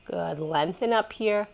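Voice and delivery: ordinary talk, nothing marked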